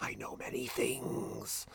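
slow, hushed mysterious voice; eerie whisper. Retold or imitated. The voice on the phone in the film, slow and eerie. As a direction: whisper it like a sinister caller.

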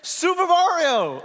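high, drawn-out triumphant name call; big hammed-up reveal of the name; Mario announcing himself. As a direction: stretch out "Super Mario" high and slow. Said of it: "he's just saying".

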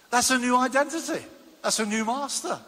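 ordinary delivery

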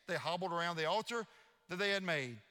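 ordinary delivery

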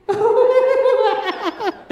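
loud, high burst of real laughter; big laugh. Real, not acted. Pastor telling her congregation a funny childhood story, bursting into laughter. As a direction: crack up at the memory.

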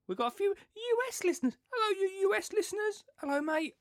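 high, exaggerated American accent greeting the listeners; hammed-up American accent, like a cheesy TV host; mock American accent. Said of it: "I won't do any American accents today".

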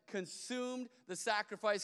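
ordinary talk

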